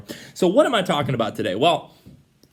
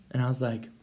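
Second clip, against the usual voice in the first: ordinary delivery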